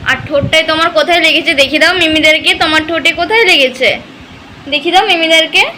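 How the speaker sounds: put-on high, sing-song baby talk to a toddler. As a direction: coo and tease the little one playfully. Mother baby-talks to her son.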